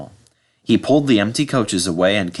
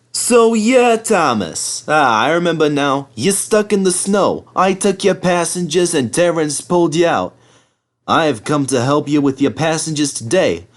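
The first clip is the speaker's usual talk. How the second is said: Bertie voice; 6 semitones higher than usual. Acted. Bertie chatting.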